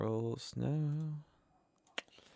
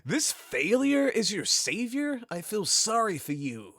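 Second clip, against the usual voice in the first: higher, slow, hammed-up hero line; melodramatic hero; 7 semitones higher, slower than usual. Quoting Chris to stream chat, slow melodramatic hero voice. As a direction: ham it up like a game cutscene. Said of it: "Chris is like".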